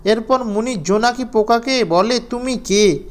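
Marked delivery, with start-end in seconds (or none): none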